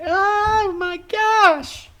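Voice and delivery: very high, slow shriek of panic; panicked cultist scream. Acted. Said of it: "all begin screaming".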